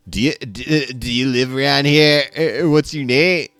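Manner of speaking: high, loud, stammering stranger; creepy stranger. Acted. Creepy man questions the player, nervous and loud.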